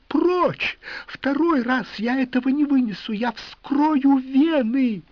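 high, loud desperate screams; hysterical screaming. A narrator's reading. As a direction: shriek it out in despair.

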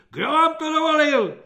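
very high, loud furious demand; shrieking. A narrator's reading. Corporal demanding who allowed it, shrieking. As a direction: screech it at the disobedient recruit. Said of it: "vřískal svobodník".

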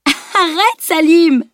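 high-pitched protesting cry; high, loud call to stop. A narrator's reading. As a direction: squeal out a playful protest. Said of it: "cria-t-elle".

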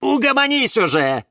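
high, loud exasperated order; exasperated telling-off. A narrator's reading. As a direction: tell the noisy one to settle down, loudly. Telling the screamer off. (раздражённый громкий окрик)

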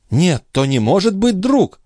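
high-pitched, insistent contradiction; animated disagreement; objecting. A narrator's reading. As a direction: counter it firmly in a high voice. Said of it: "возразила Шон".

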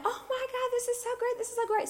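very high, squeaky re-enacted thrill; giddy mock squeal of thanks; gushing delight. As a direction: gush in a tiny high-pitched voice. Thanking the giver on the phone.

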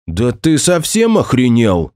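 blurted, slow and indignant; angry outburst. Acted. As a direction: throw the words out in outrage. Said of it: "выпалил он".

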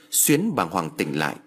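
ordinary delivery, nothing marked

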